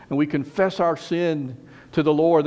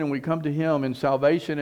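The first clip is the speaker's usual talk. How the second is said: ordinary delivery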